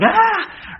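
very high mock startled scream. Horror fan jumping at a scare, mock scream. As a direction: shriek like a jump scare just hit. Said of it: "And then you're like".

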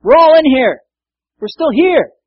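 high, loud urgent shout; yelling out. Retold or imitated. Paul yelling to the jailer about to kill himself, urgent shout. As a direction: shout to stop him in time. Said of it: "he yells out and he says".

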